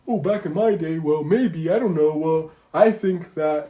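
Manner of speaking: put-on high, loud rambling old-timer voice; mock a know-it-all who won't get to the point; rambling elder. Mocking people who give biased answers, high rambling voice.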